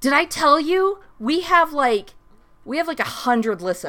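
high, loud gushing; excited. Pretend. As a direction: burst out with big news. Excited brag, mock news.

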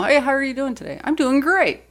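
high, chirpy work greeting; voice going up. Retold or imitated. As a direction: pitch it up high like greeting coworkers. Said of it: "Like, I just always go up".

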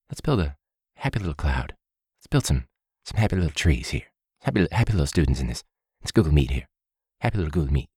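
soft, hushed painter calm; lightly voiced Bob Ross, quiet; Bob Ross impression. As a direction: dab the words on gently like paint. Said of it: "He says happy accident".

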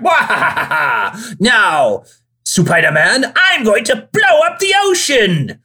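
cackling cartoon supervillain, high and loud; unhinged villain. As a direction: ham up an evil monologue, big and shrill. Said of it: "supervillains being like".

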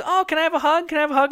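high, sing-song begging "can I have a hug"; pleading for a hug. Retold or imitated. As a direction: imitate an eager fan pestering. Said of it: "[name] comes up and goes".

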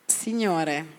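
ordinary delivery

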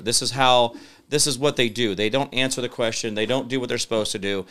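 ordinary talk

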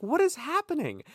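high-pitched mock confusion; retold bewilderment. Student abroad answered in Italian, comically baffled. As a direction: replay being totally thrown.

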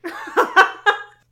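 loud, high burst of laughter; real laughter. Real, not acted. Cracks up at the punchline.

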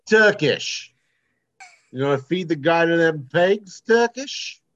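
high-pitched put-on Cockney; Cockney gangster-film accent. Shown as a demonstration. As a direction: play a Guy Ritchie crook in bad Cockney. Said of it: "or Cockney".

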